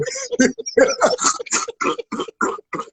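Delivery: soft, high-pitched laugh; hearty laugh at his own joke; high burst of laughter. Real, not acted. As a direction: laugh off the grim memory.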